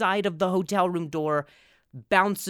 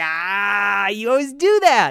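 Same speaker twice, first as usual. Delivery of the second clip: groan, then yell like a fed-up dad; dad's exasperated outburst; 3 semitones higher, 7 dB louder, slower than usual. Retold or imitated. Dad complains about the remote, mock exasperated yell. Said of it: "And he's like".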